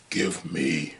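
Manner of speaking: low, hushed demon demand; demonic voice. Retold or imitated. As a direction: growl it like a possessed boy. Scott with glowing eyes demanding.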